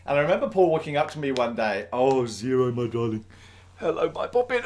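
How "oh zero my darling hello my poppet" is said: doting pet talk, swooping up on the greeting; cooing to a pet. Real, not acted. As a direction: coo fondly to a beloved pet. Cooing to Zero, probably a pet.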